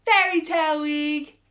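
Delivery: gasped, high and loud announcement; excited gasp. Real, not acted. Host announcing the theme week, thrilled. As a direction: reveal it like a thrilling surprise.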